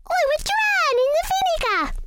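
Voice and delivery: high squeaky little mouse voice. Acted. Missus Mouse talks to herself about the food, squeaky high. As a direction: pipe up like a tiny mouse.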